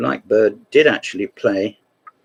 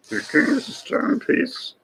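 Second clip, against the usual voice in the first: slow, much higher, hammed-up German accent; 13 semitones higher, much slower than usual. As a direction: ham up the German accent like Steinitz. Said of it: "with a German accent".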